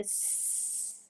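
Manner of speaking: long unvoiced sss like a snake; teacher demonstrating the snake sound; snake hiss. Shown as a demonstration. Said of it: "سانپ کی آواز کیا ہوتی ہے".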